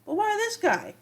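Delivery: puzzled fan asking why the Monitor picks this guy, incredulous and pitched high; baffled question. Retold or imitated. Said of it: "And I was like".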